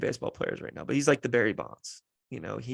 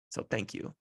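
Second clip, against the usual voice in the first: a whispered so thank you, barely there; a soft real whisper of gratitude; 11 dB quieter than usual. Real, not acted. Thanking his mother at the end.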